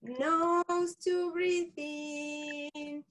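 slow, drawn-out calm instruction; slow breathing guide. Shown as a demonstration. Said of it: "Do it one more time".

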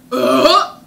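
loud fake burp; mock burp. Shown as a demonstration. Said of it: "someone in your classroom goes".

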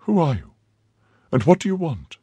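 high, alarmed cry of fear; frightened cry. Acted. Milady, startled by the cloaked figure, cries out in fear. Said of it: "cried she".

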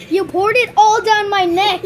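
loud, slow shocked cry at the icy water; shocked yelp. Real, not acted. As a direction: gasp it out, stunned by the cold. Ice water poured down his neck.

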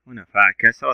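ordinary talk

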